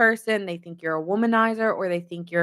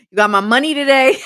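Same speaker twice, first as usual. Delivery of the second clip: loud, fast, much higher mock demand; forceful demand; 7 semitones higher, 10 dB louder, faster than usual. Ex demanding money from the reader, loud mock demand. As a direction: bark it out like a pushy ex chasing money. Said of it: "they're pretty forceful".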